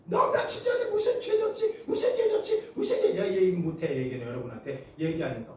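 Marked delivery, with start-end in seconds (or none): 0.0-3.2 high-pitched accusing demon voice; acted possessed child taunting mother; demon cursing. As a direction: screech the accusation, hammering each repeat. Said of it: "하면서 엄마를 욕하고".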